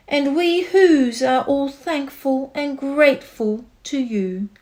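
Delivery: proud little mayor calling up; calling tiny mayor. A narrator's reading. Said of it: "called the voice".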